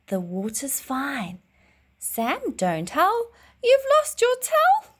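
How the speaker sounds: animated storybook reading with big pitch leaps; lively read-aloud. A narrator's reading. Teacher reading a swimming rhyme to her class, animated and sing-song. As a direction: bounce through the rhyme, calling out the kids' lines.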